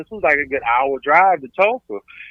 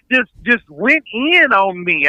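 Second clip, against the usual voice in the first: ordinary talk, nothing marked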